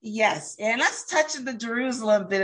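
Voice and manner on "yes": ordinary talk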